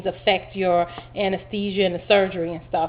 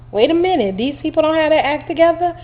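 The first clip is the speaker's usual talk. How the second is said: alarmed disbelief; 8 semitones higher, 4 dB louder than usual. Retold or imitated. Patient alarmed by OR staff.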